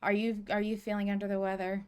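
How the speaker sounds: normal talking voice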